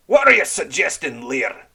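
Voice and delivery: pirate enraged at an accusation; menacing challenge. Acted. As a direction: dare him to say it. Red Ram flushes dangerously.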